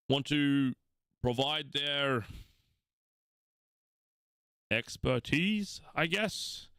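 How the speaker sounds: high, drawn-out weary royal adviser; slow and unimpressed, like he'd rather not bother; Aldeus, bored. Acted. GM voices Aldeus.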